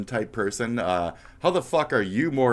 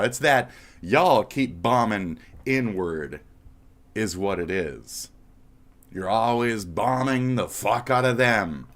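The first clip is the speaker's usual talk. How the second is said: ordinary talk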